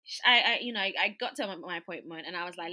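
normal talking voice

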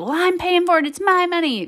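put-on high-pitched, indignant kid retort; child's protest. Son arguing with mom over a drink, protesting. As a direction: argue back like an aggrieved child. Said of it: "got into a big old issue".

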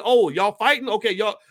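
high, eager voice of someone jumping in; playful imitation of eager recruits; eager joiner. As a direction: sound thrilled to join the fight.

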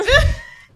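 loud, high burst of laughter; real laughter. Real, not acted. Friend laughing at own Valentine's complaint, loud laugh. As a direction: crack up at your own joke.

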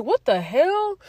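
retold high-pitched bewilderment; baffled, pitch jumping up; startled confusion. Telling how someone called my name, high-pitched bewilderment.

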